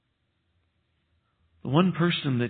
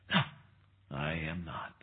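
hushed denial; 8 semitones lower, 14 dB quieter than usual. Retold or imitated. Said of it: "said to Peter".

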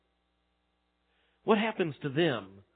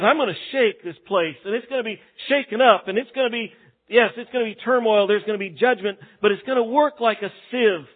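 higher, louder declaring voice; forceful paraphrase of God; 5 semitones higher, 5 dB louder than usual. Retold or imitated. Preacher voicing God's promise, loud and emphatic. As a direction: announce the shaking with force. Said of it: "He says".

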